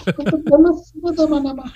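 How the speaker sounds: high-pitched, hammed-up Indian accent; cartoonish high Indian caricature; mock Indian accent. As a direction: put on a squeaky mock Indian voice. Mocking Indian call-centre worker.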